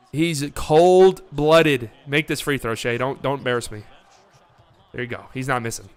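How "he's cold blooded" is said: cold-blooded stretched out very slowly, announcer style; slow dramatic drawl. Pretend. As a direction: draw out every syllable of cold-blooded. Hype call on a clutch shooter.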